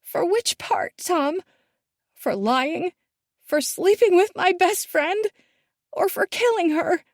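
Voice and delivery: high, rising, bitter questions; bitter accusing. A narrator's reading. Daughter confronting her father, high and bitter. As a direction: throw each charge at him.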